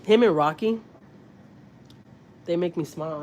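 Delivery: normal talking voice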